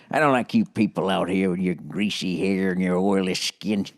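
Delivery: low, menacing mafia don grumble; Michael Corleone impression. Mimicking the Godfather to podcast listeners, a low mob-boss grumble. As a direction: growl it like a Godfather boss passing judgment. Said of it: "I wonder if Michael Corleone's gonna go there".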